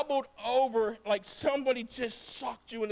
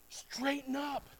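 soft echo; 2 semitones higher, 7 dB quieter, much slower than usual. Shown as a demonstration. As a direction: drop Straighten up to a quiet aside. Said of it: "better understanding".

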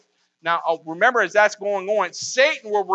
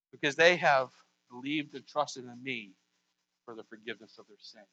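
fading hush; 7 semitones lower, 10 dB quieter than usual. Pretend. Soft close of quote.

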